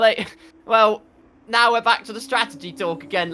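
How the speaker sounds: ordinary delivery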